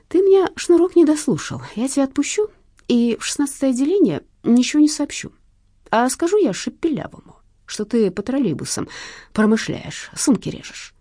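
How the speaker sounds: high, measured policeman with a threat; needling cop. A narrator's reading. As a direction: dangle the threat before the thief.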